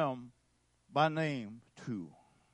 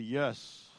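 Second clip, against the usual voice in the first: normal talking voice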